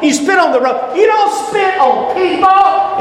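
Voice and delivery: high shouted outrage; preacher protesting against spitting on people, shouting; indignant shout. Real, not acted. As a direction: cry it out, scandalised. Preacher's rising indignant climax.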